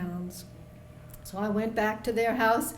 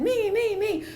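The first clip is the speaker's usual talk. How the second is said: put-on eager children; 11 semitones higher, 5 dB louder than usual. Children all answering eagerly.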